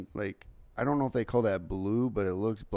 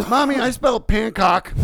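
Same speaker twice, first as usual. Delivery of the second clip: put-on high, loud child's announcement; imitated daughter; 13 semitones higher, 13 dB louder than usual. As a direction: shout it to Mommy like an excited kid. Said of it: "so Jessica comes in".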